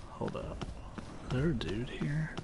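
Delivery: hushed whisper, barely voiced. Real, not acted. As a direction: whisper it so the enemy won't hear. Sneaking, suspects enemy nearby.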